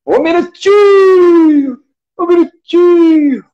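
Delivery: long, drawn-out, high rodeo call; hyped rodeo announcer. Pretend. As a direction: stretch it out like a rodeo announcer at the gate. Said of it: "Segura, peão".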